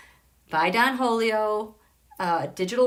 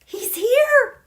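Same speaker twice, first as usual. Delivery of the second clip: high, louder squeal of excitement; thrilled; 13 semitones higher, 6 dB louder than usual. Real, not acted. Astrologer finding Teddy Roosevelt in the chart, thrilled. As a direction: announce the arrival in a high, excited cry.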